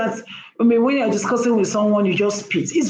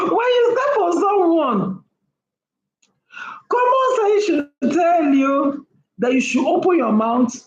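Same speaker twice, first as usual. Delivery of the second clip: emphatic lecture; 7 semitones higher than usual. Real, not acted. Lecturing loudly after laughing.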